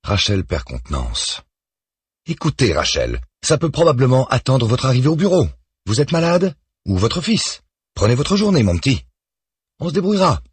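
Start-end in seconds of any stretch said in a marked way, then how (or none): 2.2-10.4 much higher, louder, irritated boss voice; a busy superior brushing off her assistant; curt and condescending; annoyed boss. A narrator's reading. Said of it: "l'air si agacé".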